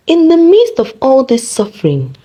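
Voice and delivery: ordinary delivery, nothing marked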